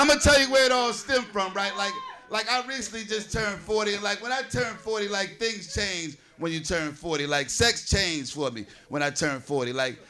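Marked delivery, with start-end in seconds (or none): none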